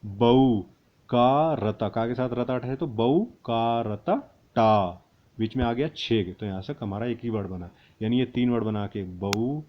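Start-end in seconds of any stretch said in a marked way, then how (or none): 2.9-4.9 slow, syllable by syllable; slow spelling out. Shown as a demonstration. Teacher spelling letters slowly for students. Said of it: "पढ़ने का तरीका सिखाऊं".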